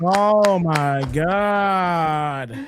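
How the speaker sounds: hugely stretched groan of disbelief; drawn-out disbelief. Real, not acted. Co-host despairs at the wrestling show, drawn-out disbelief. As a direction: stretch every word out very slowly.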